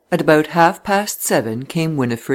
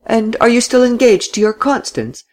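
high, lightly mocking question; touch of mockery; 9 semitones higher than usual. A narrator's reading. Laura asking Coots about his engagement, a touch of mockery. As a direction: needle him lightly in a raised pitch. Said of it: "with a touch of mockery".

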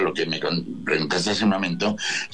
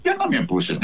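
normal talking voice

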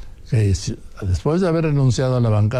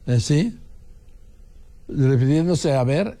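normal talking voice

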